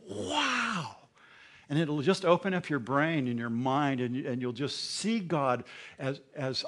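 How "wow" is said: amazed. Pretend. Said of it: "you go".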